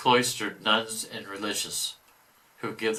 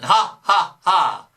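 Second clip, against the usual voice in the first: short evil cackle, pitched up; devil's laugh; 9 semitones higher, 8 dB louder, much slower than usual. Acted. Devil sure he will win, mock evil cackle. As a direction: cackle in triumph.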